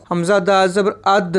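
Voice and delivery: "अद" ending in a bounced d with a short echo; qalqala bounce. Shown as a demonstration. Teacher spells out a qalqala letter, "अद" with bounced d. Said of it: "इसको हम हलाका पढ़ेंगे".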